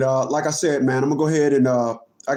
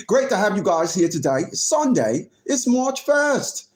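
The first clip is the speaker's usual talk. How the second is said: mock British voice, often pitched up; put-on British accent; 3 semitones higher than usual. Streamer applauding a chatter's compliment, hammed-up British accent. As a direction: play the pleased Brit. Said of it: "Great Britain would be proud to have you".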